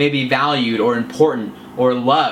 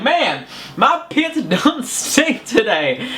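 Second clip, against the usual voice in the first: much higher, slow hammed-up Southern drawl through a laugh; goofy Southern country voice; 10 semitones higher, much slower than usual. Shown as a demonstration. Coach demos a ridiculous Southern line to make viewers laugh. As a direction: brag like a proud country bumpkin. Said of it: "it gets southern or country".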